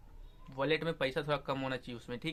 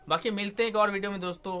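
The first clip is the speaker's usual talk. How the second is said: quicker, higher and louder cheerful goodbye; upbeat sign-off; 5 semitones higher, 4 dB louder, faster than usual. Real, not acted. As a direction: brighten up and rush the farewell to viewers. Upbeat outro sign-off to viewers.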